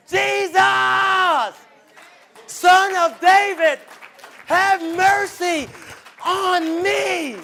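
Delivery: high, slow, shouted plea; crying out for mercy. Retold or imitated. Blind man crying out to Jesus, slow shouted plea. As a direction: beg loudly across a crowd. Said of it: "you don't know who you talking to".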